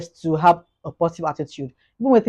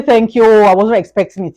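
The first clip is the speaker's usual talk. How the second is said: higher, louder, feigned surprise at the gift; mock surprised thanks; 4 semitones higher, 7 dB louder than usual. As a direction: put on a show of not expecting the gift. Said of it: "even though you're expecting it".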